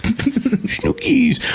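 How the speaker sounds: very high, drawn-out silly voice; playful high-pitched greeting. Acted. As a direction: coo the nickname in falsetto. Silly greeting to listener's nickname.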